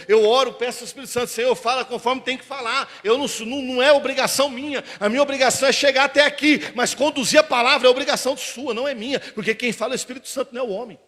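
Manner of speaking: loud, high, driving sermon climax; full-force preaching. Acted. Pastor rouses the congregation to trust God, in full-force preaching.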